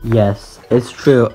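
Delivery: normal talking voice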